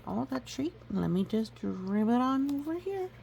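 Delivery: high, sweet coaxing voice; coaxing owner. Acted. Owner luring the donkey, high and coaxing. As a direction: lure him gently with the treat. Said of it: "They'll say".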